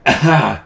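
loud throat-clearing cough. Real, not acted. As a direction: clear your throat hard. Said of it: "I'm just gonna clear my throat".

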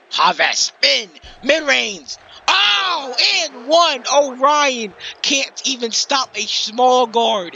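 loud, high, stretched play-by-play yell; excited shout. Real, not acted. Commentator yelling over a big and-one. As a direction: hype the big play at full voice.

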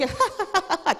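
high mock laugh; mock laughter. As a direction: put on a laugh at a joke. Imitates laughing at jokes.

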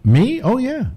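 high surprised me oh yeah. Real, not acted. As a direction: caught off guard, pitch jumps up. Surprised reply to license question.

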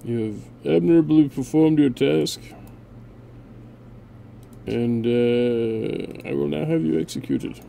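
lightly hammed-up royal decree; a hint of a cold tyrant; mock ruler. Ruler addressing his unit, mildly mock regal. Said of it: "No, I'm just kidding".

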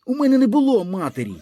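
high, loud and fast, near a shriek; boy shouting back at teasing kids; angry outburst. A narrator's reading. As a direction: blurt it out in fury. Boy denies having a mother.

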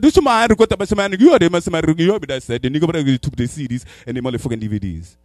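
loud mock-African babble, easing into English; mock African accent. African roommate explains the theft, loud mock-accent babble. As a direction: burst out loudly in a put-on African accent. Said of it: "he speak half his language".

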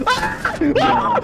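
sudden high startled shrieks; startled screams. Real, not acted. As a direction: shriek out in fright. Jump scare in game.

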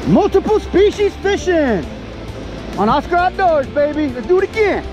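high, punchy hype to camera; big, high-pitched host energy; hyped show sign-off. Real, not acted. Angler sums up the multiple species catch for viewers, hyped.

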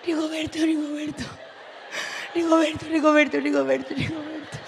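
llama a Rigoberto en voz baja, sin gritar; llamado ahogado. Retold or imitated. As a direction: llamar bajito para no despertar a la niña. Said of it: "¿cómo grito si está la niña dormida?". (susurro urgente a Rigoberto)

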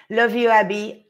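normal talking voice

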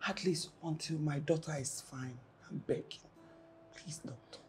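very soft, low, half-whispered begging; hushed pleading. Acted. Mother asking doctor to keep a secret, hushed pleading. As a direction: beg secretly, almost without voice.

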